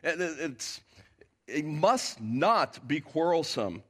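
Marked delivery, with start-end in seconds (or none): 1.5-3.8 slow, with must punched hard; slow, weighty stress on "must"; emphatic. Shown as a demonstration. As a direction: press down on "must" in "it must not be quarrelsome", slowly. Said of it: "It's very, very emphatic".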